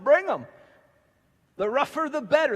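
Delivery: first shout of The rougher, the better with a rise; excited call. Acted. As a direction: fling it out like a rallying cry. Pastor calls out his slogan.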